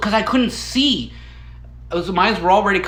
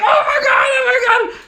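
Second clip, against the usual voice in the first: much higher, loud laughing shriek of disbelief; thrilled shriek; 5 dB louder than usual. Retold or imitated. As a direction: squeal like you just won the lottery. Picked from the audience, thrilled.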